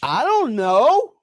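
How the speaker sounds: yelled back. Retold or imitated. Husband yelling back to wife in another room, slow loud call. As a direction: shout it back across the house. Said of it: "And I yelled back to her".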